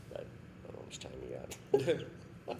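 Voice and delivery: slow, breathy whispered tease; whispered aside. Real, not acted. Teasing the hosts before telling his story, whispered.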